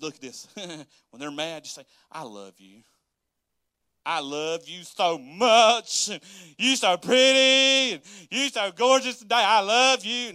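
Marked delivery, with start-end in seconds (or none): 2.1-2.8 soft, low declaration of love; calm affection. Shown as a demonstration. Models calm reply to angry spouse. 4.0-6.1 loud, drawn-out gushing; over-the-top affection. Shown as a demonstration. Exaggerates the loving reply. 6.6-10.3 loud, high, stretched compliments; hammed-up gushing. Shown as a demonstration. Hammed-up compliments to angry spouse.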